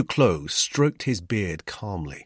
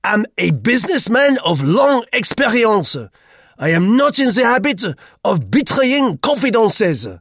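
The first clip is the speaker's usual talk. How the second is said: put-on stern, self-important old Frenchman drawing himself up; 11 dB louder than usual. A narrator's reading. Duclos answers his accuser, sternly. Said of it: "he said sternly".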